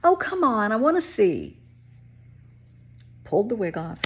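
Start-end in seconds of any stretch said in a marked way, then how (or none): 0.0-1.6 high, louder wheedling voice; pushy HR woman. Retold or imitated. HR woman begging to see her bald head, high and wheedling. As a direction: wheedle like a nosy coworker.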